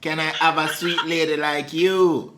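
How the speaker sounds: flirty Jamaican patois; Jamaican accent. Pretend. Wooing a sweet lady in a Jamaican accent. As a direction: chat her up in a Jamaican accent. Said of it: "You just did that accent".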